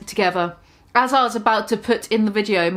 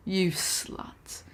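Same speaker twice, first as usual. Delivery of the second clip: whispered aside; 4 semitones lower, 12 dB quieter than usual. Real, not acted. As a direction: breathe it as a side jab. Reader's aside insult.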